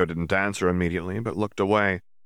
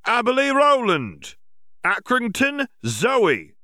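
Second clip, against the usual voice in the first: much higher, louder official announcement; announcing; 5 dB louder, slower than usual. Acted. Berrycloth announces to the students, loud and high. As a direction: call the names out to the hall. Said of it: "Berrycloth announced".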